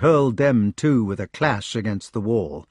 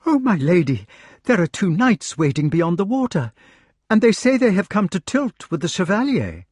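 high-pitched gentlewoman with urgent news; agitated gentlewoman; 8 semitones higher than usual. A narrator's reading. Gentlewoman reporting waiting knights to her lady, agitated. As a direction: voice a flustered messenger, pitched well up.

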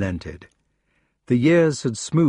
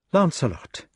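higher-pitched, mildly angry lady; angry reproach; 7 semitones higher than usual. A narrator's reading. Guinevere rebuking Lancelot over Arthur, mildly angry. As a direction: rebuke him by name, pitched up. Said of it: "she said angrily".

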